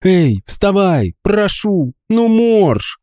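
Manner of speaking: high, slow pleading. A narrator's reading. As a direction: beg him to wake up, pitched high and slow. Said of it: "взмолилась она".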